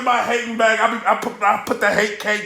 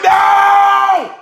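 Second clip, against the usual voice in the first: sharp, high, loud cry of denial; loud anguished no; 9 semitones higher, 5 dB louder than usual. Real, not acted. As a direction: scream it like your pick just lost. Reacting to rival's win.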